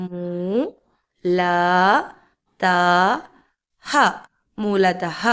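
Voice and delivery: മൂ, ല, ത, ഹ with long gaps, then മൂലതഹ joined; syllable-by-syllable reading. Shown as a demonstration. Teacher showing learners how to read മൂലതഹ syllable by syllable. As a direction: spell out "moo, la, ta, ha", then blend. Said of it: "അപ്പം എങ്ങനെയാണ് വായിക്കേണ്ടത്?".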